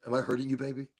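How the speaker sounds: soft cooing to the device; tender baby talk. Acted. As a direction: gently coo.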